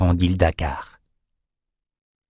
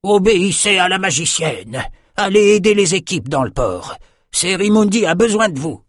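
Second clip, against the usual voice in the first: bellowed orders; 9 semitones higher than usual. A narrator's reading. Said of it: "se pencha et beugla".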